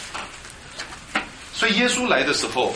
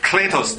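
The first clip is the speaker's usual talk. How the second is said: "Kletos" with "kle" raised and stressed; 6 semitones higher than usual. Shown as a demonstration. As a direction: put the weight on "kle" in "Kletos". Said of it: "重音在第三个音节".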